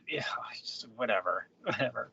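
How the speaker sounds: unvoiced, soft dismissive trailing off; whispered whatever. Real, not acted. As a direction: let it fade to a whisper.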